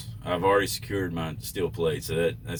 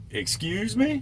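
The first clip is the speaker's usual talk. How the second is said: indignant excuse me; much slower than usual. Retold or imitated. Indignant reply to the rude driver.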